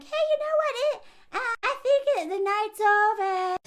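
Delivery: high, sweet voice easing out; high polite brush-off. Retold or imitated. Woman ending the night with drunk men, high sweet voice.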